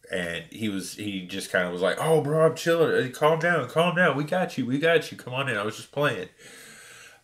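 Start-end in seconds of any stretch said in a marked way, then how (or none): 2.0-6.3 high, loud, playful calm down; teasing reassurance. Retold or imitated. Store worker calming an angry customer after a prank, high and playful. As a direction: laugh it off like a prankster. Door guy calming him down, laughing.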